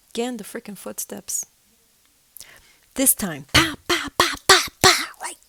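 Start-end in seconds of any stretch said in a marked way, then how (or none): 3.5-5.1 loud, high stomping pa pa; heavy footstep sounds. Shown as a demonstration. Imitating the ghost's steps on the stairs, loud stomps. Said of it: "rough going up and down the stairs".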